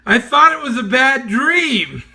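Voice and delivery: aghast, as if it couldn't be real; horrified realisation. Retold or imitated. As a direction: guest learning the truth, mock horrified. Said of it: "his exact words back were".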